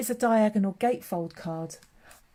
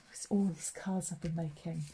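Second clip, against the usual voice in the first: softer, breathy aside whispered to herself; 5 dB quieter than usual. Real, not acted. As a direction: drop to a whisper as if sharing a secret. Aside while showing her cards.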